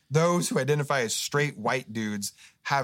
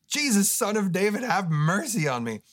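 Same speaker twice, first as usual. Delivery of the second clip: higher, louder desperate cry; louder call; 9 semitones higher, 4 dB louder than usual. Retold or imitated. Blind man crying louder to Jesus, louder plea. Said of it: "the guy just gets louder".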